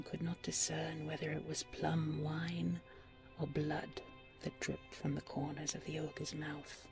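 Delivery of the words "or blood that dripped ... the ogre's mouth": plain reading voice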